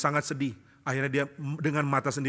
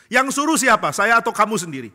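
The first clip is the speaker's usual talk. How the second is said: high, loud taunting questions from the devil; pressing devil; 8 semitones higher, 8 dB louder than usual. Acted. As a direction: corner him with each question. Devil father interrogates the lawyer. (setan mendesak, tinggi dan keras)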